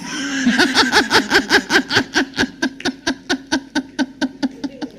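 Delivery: high rolling laugh, softening gradually; long laugh. Real, not acted. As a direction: break into a long laugh at your own joke. Laughing at own retort.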